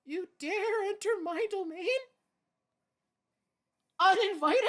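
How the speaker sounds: loud, high, booming villain threat; booming echoing villain monologue. Pretend. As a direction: boom it out like an evil overlord. Mock villain threat to gnat.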